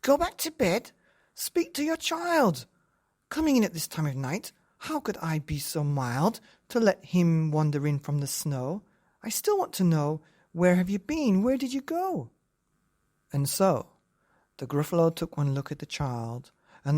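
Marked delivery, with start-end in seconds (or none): 0.0-0.9 much higher, incredulous echo; indignant wife. Acted. Wife echoes dad indignantly. 1.3-12.3 scolding wife. Acted. Wife scolds husband and child.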